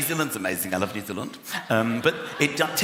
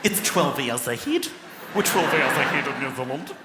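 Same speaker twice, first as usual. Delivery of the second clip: lightly put-on Kiwi stewardess; New Zealand accent. Stewardess answering passenger in a light New Zealand accent. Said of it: "She went".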